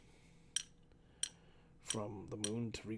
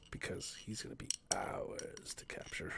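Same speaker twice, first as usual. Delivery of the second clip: secretive whisper; 5 semitones lower than usual. Real, not acted. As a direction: plot it under your breath. Conspiratorial whisper about Santa.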